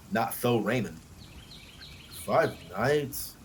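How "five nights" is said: glumly. A narrator's reading. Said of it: "he said glumly".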